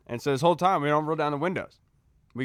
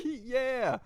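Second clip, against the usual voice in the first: sheepish yeah; 9 semitones higher, 5 dB quieter than usual. Retold or imitated. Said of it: "And I was like".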